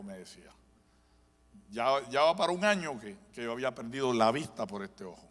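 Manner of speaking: normal talking voice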